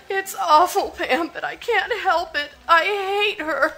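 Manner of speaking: near-hysteria, sobbing out her hatred; confessing her hate, close to tears; hysterical outburst. Acted. Sister breaks down in hatred.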